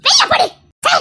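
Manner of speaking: high, sharp shout to get out; furious shout. Acted. Mother yelling at the intruder to leave.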